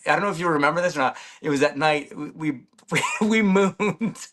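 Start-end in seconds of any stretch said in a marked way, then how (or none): 2.3-4.3 laughing. Real, not acted. Guest confessing an on-air stunt to the host, laughing. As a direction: laugh through the words as you get them out.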